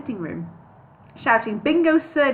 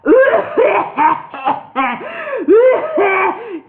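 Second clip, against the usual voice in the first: high loud hacking laugh; laughing coughing fit; 11 semitones higher, 8 dB louder than usual. Acted. Wizard shakes with a loud high laughing cough. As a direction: laugh and cough till shaking. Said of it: "coughing so abruptly".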